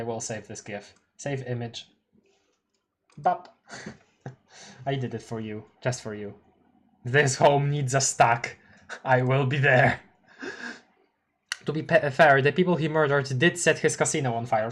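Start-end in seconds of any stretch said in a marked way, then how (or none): none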